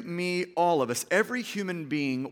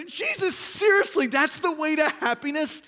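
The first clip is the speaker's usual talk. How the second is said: high, loud, incredulous squeak of disbelief; 9 semitones higher, 3 dB louder than usual. Retold or imitated. Preacher mock-asking Jesus about happiness, incredulous. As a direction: ask it as if you can't believe it. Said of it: "I just read that and I'm like".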